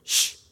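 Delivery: a sharp shh; long hiss of a shush; hushing. A narrator's reading. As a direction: shush someone into silence. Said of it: "sagde, de skulle tie stille".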